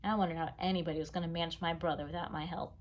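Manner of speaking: usual speaking voice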